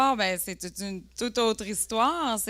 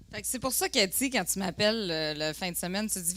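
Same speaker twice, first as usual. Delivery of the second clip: ordinary delivery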